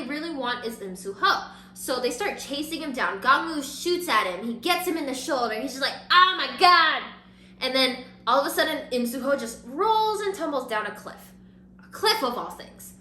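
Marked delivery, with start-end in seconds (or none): 6.1-7.0 loud, high hammed-up exclamation; dramatic imitated yell; mock agonized cry. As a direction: cry out as if just shot. Acts out man shot in shoulder.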